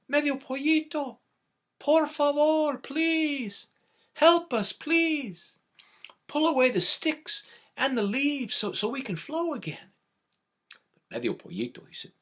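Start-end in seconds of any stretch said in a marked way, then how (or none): 0.1-9.9 high, thin pleading creek voice; the creek begging the chick for help; pleading water voice. Acted. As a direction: beg like the clogged water.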